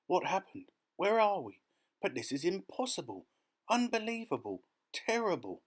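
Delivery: high, exclaiming alarm; shocked disbelief. A narrator's reading. Insects seeing where they are, shocked disbelief. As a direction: reel from one shock to the next. Insects find themselves lost at sea.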